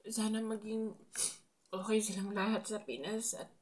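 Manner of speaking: tearful voice, high and breaking; crying while talking. Real, not acted. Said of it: "Naiyak ako".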